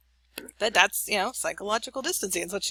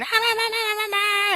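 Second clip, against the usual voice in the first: much higher, louder mock teen shriek; mock screaming; 5 dB louder than usual. Teen daughter shrieking at mom in a fight, louder and much higher. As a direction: shriek like a furious teenage girl. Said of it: "she's yelling and screaming".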